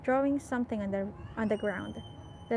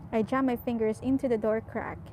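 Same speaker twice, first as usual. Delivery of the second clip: usual speaking voice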